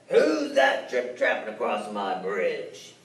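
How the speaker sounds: deep troll. Acted. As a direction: rumble it slowly, menacing. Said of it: "that mean old troll said".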